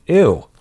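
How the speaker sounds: loud, high, disgusted ew; real disgust at a foul taste. Real, not acted. Tasting the dead fish bean.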